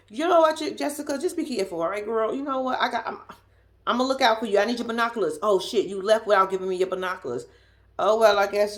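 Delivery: normal talking voice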